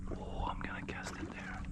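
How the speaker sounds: breathy whisper before the cast; excited whispered plan. Real, not acted. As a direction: whisper so the fish won't hear. Sneaking up on fish.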